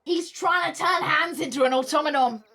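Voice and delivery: loud, high, melodramatic exclamation; mock horror. Streamer guessing father's plan for Hans, loud mock horror. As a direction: cry it out as a shocking revelation. Mock horrified realisation after gasp.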